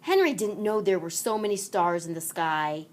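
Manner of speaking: ordinary delivery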